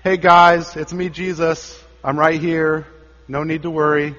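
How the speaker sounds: breezy, high and loud, like a buddy waving hello; mock casual Jesus. Preacher mocking a too-casual Jesus, breezy and loud. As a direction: toss it off like a casual hey-guys greeting. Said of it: "he isn't just saying".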